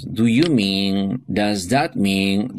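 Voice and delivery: slow, careful model reading; teacher modelling useful phrases slowly. Shown as a demonstration. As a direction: spell the phrases out slowly for learners. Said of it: "a couple of expressions".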